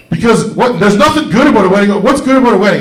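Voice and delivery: mock outrage. Real, not acted. Comic ranting at the crowd about weddings, mock outraged. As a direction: scoff at the very idea of weddings.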